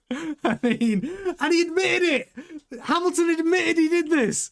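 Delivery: can't stop chuckling as he tells it; laughing. Real, not acted.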